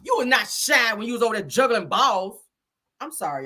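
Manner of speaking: ordinary delivery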